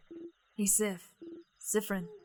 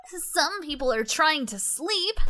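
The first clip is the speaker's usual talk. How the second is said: slow, higher grumpy reprimand; stern Odile; 5 semitones higher, slower than usual. Acted. Odile scolds the noisy party, slow and stern. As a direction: scold the noisy ones, dragging it out.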